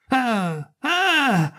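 high mocking falsetto laugh, ah ah; shrill falsetto cackle. Mad mate shrieks at frightened crew, falsetto cackle. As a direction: cackle up high to taunt them. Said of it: "strillando furiosamente".

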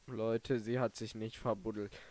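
normal talking voice

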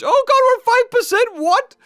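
high panicked yelp; surprised. Real, not acted. Battery suddenly at five percent.

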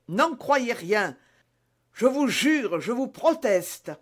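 high, slow, drawn-out pleading; pleading protest. A narrator's reading. D'Artagnan swears innocence to the dying man, pleading. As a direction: beg desperately to be believed.

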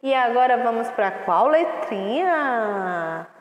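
ordinary talk

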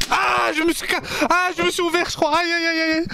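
high-pitched yelp of pain; real startled ow-ow-ow, voice shooting up; crying out. Real, not acted. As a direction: yelp as if you just hurt yourself. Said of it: "je commence déjà à crier".

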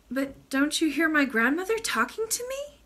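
high, pleading princess; mildly tearful appeal, rising at the end; almost crying. A narrator's reading. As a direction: plead as if near tears. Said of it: "almost crying".

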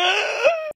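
squeaky high giggle; short high cackle; high laugh. Real, not acted. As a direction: burst of laughter. After singing.

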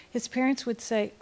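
normal talking voice

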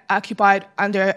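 ordinary talk, nothing marked

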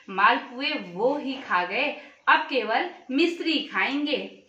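plain reading voice

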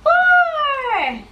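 squealed high note; high sing-song. Acted. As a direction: hit a high playful peak. Counting game with toddler.

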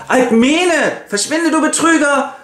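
high, shouting accusation; furious husband driving off the impostor; shrill outraged cry. Acted. Amphitryon yells at impostor. (schrilles, empörtes Anschreien)

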